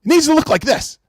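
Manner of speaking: high-pitched, heated rant; mad. Real, not acted. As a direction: vent at the reviewers. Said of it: "and I'm sorry I'm mad".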